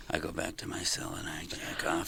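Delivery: hushed, breathless whisper. Retold or imitated. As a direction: whisper the confession. Retold inmate confession, whispered.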